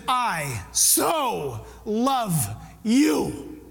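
very slow, "so" stretched; tender stress on so. Acted. God telling everyone, very slow stretched words. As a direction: draw out "so" tenderly. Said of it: "And God says".

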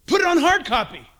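loud call to the crew; loud offstage call to the camera crew. Real, not acted. Preacher calling out to crew.

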